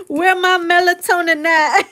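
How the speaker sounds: high, laughing as she acts it; laughing quote. Acted. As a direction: giggle through the line.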